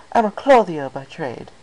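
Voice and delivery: a hint of an elegant fashionista; lightly voiced Rarity.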